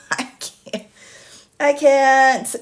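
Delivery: breathless laugh, then a burst of I can't; exasperated laugh. Real, not acted. Fed-up legal commentator laughing at a motion. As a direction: give up laughing.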